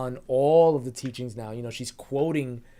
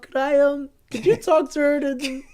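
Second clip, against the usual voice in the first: put-on high, loud, pleading kid voice; awkward boy king begging the priest; whiny boy king; 10 semitones higher, 9 dB louder than usual. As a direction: ham up a timid boy asking a priest for help. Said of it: "he's like".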